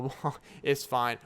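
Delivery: normal talking voice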